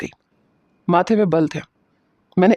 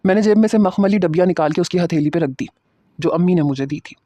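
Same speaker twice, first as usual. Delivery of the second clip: ordinary delivery, nothing marked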